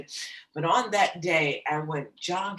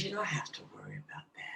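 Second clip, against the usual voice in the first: quick soft whisper; whispered reassurance; 2 semitones higher, 12 dB quieter, much faster than usual. Retold or imitated. Psychic comforting the worried daughter, whispered. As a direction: hush it out, quick and soothing.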